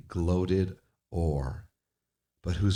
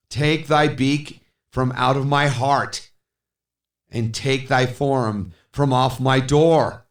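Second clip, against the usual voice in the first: higher, louder, furious cry; shrieking command; 6 semitones higher, 4 dB louder than usual. Acted. Speaker shrieks at the raven to leave. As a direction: drive the bird out. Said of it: "I shrieked".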